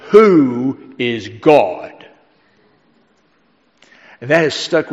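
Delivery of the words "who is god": very slow, low South Carolina drawl; thick South Carolina accent. Retold or imitated. Burly deacon opening an ordination examination, thick South Carolina accent. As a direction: draw it out deep in a heavy South Carolina accent. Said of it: "in this thick South Carolina accent".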